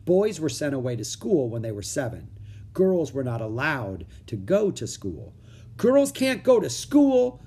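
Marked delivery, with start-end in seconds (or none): 5.8-7.4 put-on high, slow little-girl voice; a bright, high girl's protest; Annie's voice. A narrator's reading. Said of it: "said Annie".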